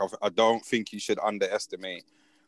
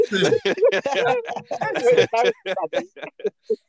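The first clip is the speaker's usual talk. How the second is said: big laugh; 5 dB louder than usual. Real, not acted. Laughing at own impression.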